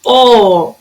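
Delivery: a long, held "O"; lengthened syllable. Shown as a demonstration. Teacher isolating the strong syllable, held "O". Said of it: "A sílaba que durou mais tempo".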